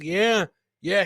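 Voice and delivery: ordinary talk, nothing marked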